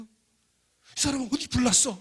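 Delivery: slow, loud, high exasperated scolding. Retold or imitated. Professor scolding student for ringing a fire alarm, loud exasperated scold. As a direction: scold him, stretching each word.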